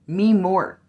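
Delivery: loud, insistent echo; emphatic repeat. Shown as a demonstration. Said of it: "and I love me more".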